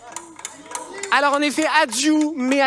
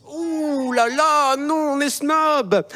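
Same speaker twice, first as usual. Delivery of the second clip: slow, drawn-out snooty voice; mock snob; 3 semitones higher, much slower than usual. Host mocking the crowd as snobs, drawn-out snooty tone. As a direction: ham up a snobbish sigh. Mocking snobbish audience reaction.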